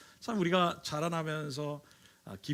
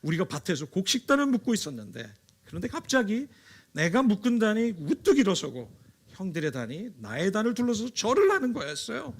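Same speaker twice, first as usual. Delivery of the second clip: 흥분한 요셉; 5 semitones higher, 5 dB louder than usual. Acted. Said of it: "막 흥분해서 얘기합니다".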